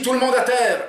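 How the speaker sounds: high, loud order spoken plainly; Jean repeating the order clearly, without the accent; clear command. Acted. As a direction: repeat it so everyone understands. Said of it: "répète Jean".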